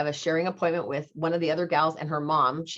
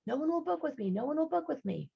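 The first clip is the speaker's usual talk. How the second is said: high, softer echo; mock echo; 11 semitones higher, 6 dB quieter than usual. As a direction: fade it like an echo.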